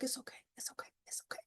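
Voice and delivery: soft whispered it's okay; crying woman whispering to other drivers; whispered reassurance. Retold or imitated. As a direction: whisper gently to strangers. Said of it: "I'm like".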